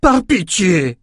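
high desperate plea; pleading. A narrator's reading. Ren begs for mercy, high desperate plea. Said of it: "implora Ren".

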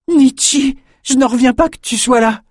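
very high, loud excited cry; young man's thrilled shout; overjoyed greeting. Acted. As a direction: burst out with delight at seeing her. Bannon greets her overjoyed.

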